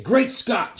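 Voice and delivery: rallying "Great Scott". Retold or imitated. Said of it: "all his men used to say".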